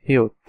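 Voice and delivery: হিউত said as Korean "hi-eut"; teacher naming the letter হিউত; Korean letter name. Shown as a demonstration. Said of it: "তারপরেই আসছে".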